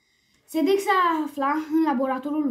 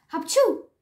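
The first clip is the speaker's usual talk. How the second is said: loud, high achoo; acted sneeze; 6 semitones higher, 5 dB louder than usual. A narrator's reading. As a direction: burst into a sneeze. The allergic king sneezes. (strănut zgomotos)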